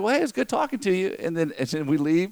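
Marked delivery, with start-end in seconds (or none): 0.0-1.2 mock cheery farewell, pitched higher; friendly goodbye. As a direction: warm small-talk sign-off, lightly played. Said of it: "I was like".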